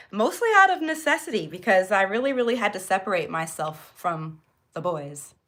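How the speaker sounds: normal talking voice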